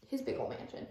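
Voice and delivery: under her breath, airy; whispered aside. Real, not acted. The vlogger whispering an aside about the mansion. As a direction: slip it in as a secret.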